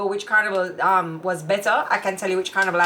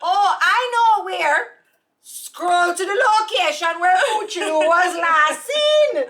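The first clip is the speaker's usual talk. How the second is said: high, loud teasing squeal; hammed-up gushing follower voice; mock fans; 11 semitones higher, 4 dB louder than usual. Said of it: "people are like".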